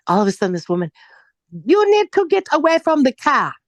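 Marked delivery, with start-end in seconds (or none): 1.6-3.5 sharp warning shout. Retold or imitated. Lyft driver ordering her away from the car, loud. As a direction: bark it out, up high and loud.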